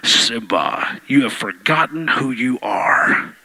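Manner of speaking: very low, slow, breathy ghostly whisper; low whispered spirit father; Mufasa voice. Retold or imitated. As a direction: whisper down deep like a ghost king. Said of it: "Actually, he says".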